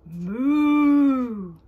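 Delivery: long loud mooing; cow moo. A narrator's reading. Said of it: "the friendly cow says".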